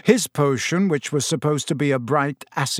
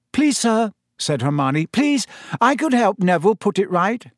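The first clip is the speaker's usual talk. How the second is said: a higher, pleading schoolgirl voice; eager schoolgirl; 6 semitones higher than usual. A narrator's reading. Clever pupil begging the teacher to let her help, eagerly. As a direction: jump in eagerly to offer help.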